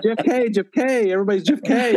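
high, worked-up mock panic; imitated crowd clamour. Crowd all interested in missing Jeff, imitated clamour. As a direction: mimic a whole crowd calling after one man.

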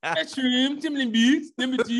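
mock Baltimore accent. Teasing over protests, mock Baltimore accent. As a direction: push the Baltimore vowels way up high. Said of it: "Baltimoreans don't sound like that".

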